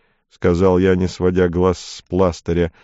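usual speaking voice

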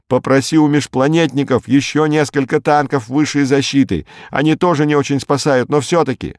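high, insistent instructions; urgent demands. A narrator's reading. Berkut asking Lemming for people and tanks, urgent. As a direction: keep pressing for help, urgently. Acted urgent requests over radio.

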